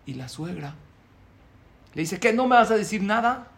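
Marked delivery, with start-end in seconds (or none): 2.2-3.5 loud, higher, challenging question; demanding. Retold or imitated. Mother-in-law goading her son-in-law to answer, loud demand. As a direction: push him to answer back.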